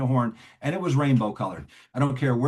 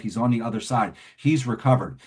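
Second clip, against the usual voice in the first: ordinary talk, nothing marked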